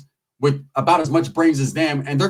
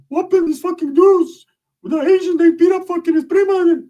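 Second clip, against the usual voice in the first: cousin retelling; 13 semitones higher, 4 dB louder than usual. Retold or imitated. Cousin begging for backup in a high whine. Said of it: "He's like".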